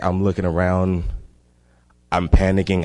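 ordinary delivery

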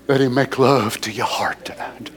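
whispered altar call. Real, not acted. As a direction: breathe it tenderly.

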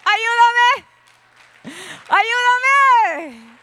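hammed-up high, loud cry for help; soap-opera melodrama. Woman pleading for rescue from the sister, telenovela wail. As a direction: wail like an overacting telenovela heroine. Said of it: "lo voy a poner así como de novela".

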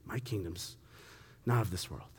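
Jesus answering Pilate softly; quiet Jesus. Retold or imitated. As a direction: lay it down gently and gravely. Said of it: "Jesus answers Pilate's first question".

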